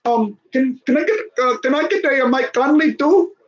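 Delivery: high, indignant put-on voice; pushy caller impression. Team boss asking Memphis for another player, mock indignant. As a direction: act out a caller begging, then mock outrage. Acts out follow-up trade phone call.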